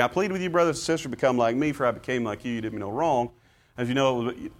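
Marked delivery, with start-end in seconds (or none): 0.0-3.3 hurried, flat read through the plea; speed-reading the scripture; rushed reading. Shown as a demonstration. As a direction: read it fast like skimming the page. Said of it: "as if we're speed reading".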